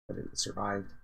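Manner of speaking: normal talking voice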